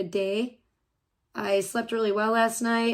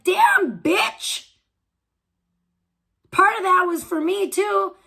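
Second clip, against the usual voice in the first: mock indignant protest; 8 semitones higher, slower than usual. Streamer voicing the man whose drugs she huffed, mock indignation. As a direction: protest in hammed-up disbelief.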